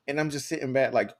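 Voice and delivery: ordinary talk, nothing marked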